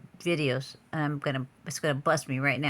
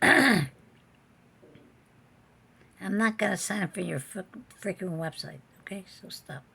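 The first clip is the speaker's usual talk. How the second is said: irritated outburst; 3 semitones higher than usual. Real, not acted. Fed up with website popups.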